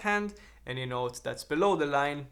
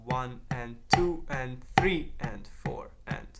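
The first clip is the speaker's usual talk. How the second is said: slow hushed count through the bar. Shown as a demonstration. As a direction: count it slowly under the breath. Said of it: "a full stroke".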